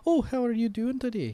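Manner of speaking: high, lilting Scottish small talk; light Scottish greeting, no shouting; everyday Scottish accent. Shown as a demonstration. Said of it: "if you're just talking that way".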